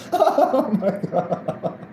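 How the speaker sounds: breathless, slow "oh my God" through giggles; can barely get it out for laughing. Real, not acted. Cracking up over her own joke, laughing.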